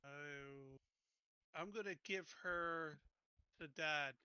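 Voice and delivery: ordinary talk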